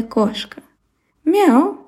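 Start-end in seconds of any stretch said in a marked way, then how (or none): 1.2-1.9 high cat meow. Acted. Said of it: "сказала кошка".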